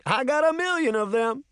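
high, pleased-with-herself character voice; joking Mama. A narrator's reading. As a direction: crow it like a comic after a punchline. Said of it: "Mama giggled at her joke".

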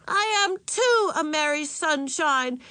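put-on high, slow, angry little-girl protest; angry shouting child. A narrator's reading. Little sister scowling back at mother, angry shout. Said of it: "she shouted angrily".

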